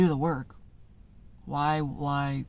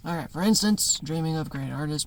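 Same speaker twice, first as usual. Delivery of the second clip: normal talking voice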